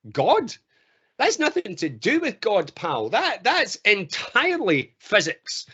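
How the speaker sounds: high, scoffing disbelief; incredulous dismissal. Acted. Sceptic answering the believer about the rainbow, incredulous and dismissive. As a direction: correct them with mock disbelief. Voicing a dismissive science-minded walker.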